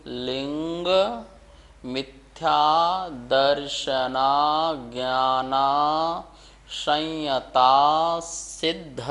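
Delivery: slow, each word set apart by pauses. Shown as a demonstration. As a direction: read each word slowly off the board for the class. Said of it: "एक एक शब्द का उच्चारण". (एक एक शब्द धीरे धीरे)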